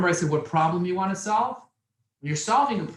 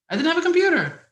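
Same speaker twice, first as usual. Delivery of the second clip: much higher, louder on the whole line; emphatic; 13 semitones higher, 7 dB louder than usual. Real, not acted. Speaker tells young men he had no computer, emphatic and higher. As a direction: hammer home the point to the crowd.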